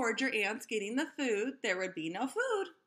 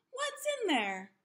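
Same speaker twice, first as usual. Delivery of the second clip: high, startled question; surprised; 12 semitones higher than usual. Acted. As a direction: ask in wide-eyed alarm. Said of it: "Tim surprised at chamber".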